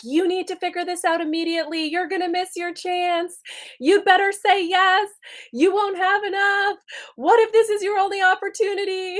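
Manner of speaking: hammed-up nagging ego; urgent ego voice. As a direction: play the pushy inner voice rushing you. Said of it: "you may hear the ego's voice and it may be like".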